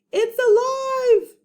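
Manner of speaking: high, loud, slow cry of alarm; dramatic chapter title. Acted. Teacher reading to class, hammed-up scared cry.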